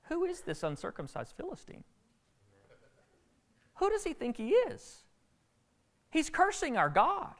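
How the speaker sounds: put-on a shepherd boy outraged at the insult to his God; indignant David. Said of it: "he's like".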